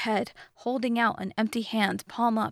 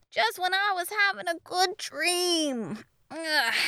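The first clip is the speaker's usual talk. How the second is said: higher, sleepy whine stretched into a yawn; yawning complaint; 9 semitones higher than usual. A narrator's reading. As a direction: grumble it through a waking stretch. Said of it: "stretching his arms and yawning".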